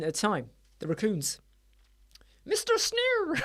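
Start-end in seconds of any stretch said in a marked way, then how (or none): 2.4-3.4 very high squeaky toon voice; cartoon pig impression. Pig henchman calling to the boss, very high cartoon voice. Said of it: "And the pigs would say".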